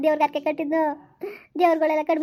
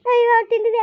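crying wail; 5 semitones higher, 6 dB louder, faster than usual. Acted. Wailing lament over childlessness.

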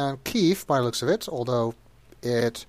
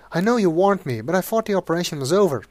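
much higher, sugary pleading voice; pleading hooker; 4 semitones higher than usual. Acted. Sweet Cheeks begs Sonny from her cell, high and sugary. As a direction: coo at him to win his help.

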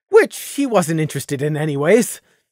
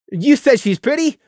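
hearty teasing buddy; teasing friend; 7 semitones higher than usual. Acted. Fiera teasing.

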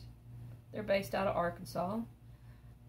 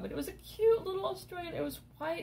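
much higher, cooing over the cute little dog; gushing over puppy; 10 semitones higher, faster than usual. Real, not acted. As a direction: coo it like you're melting over a puppy.

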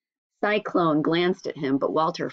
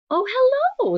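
mother's surprised greeting; 5 dB louder, slower than usual. Acted.

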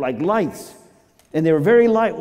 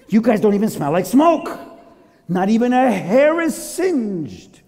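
amazed king; 8 semitones higher, 4 dB louder than usual. Acted. King marvels at unburnt men.

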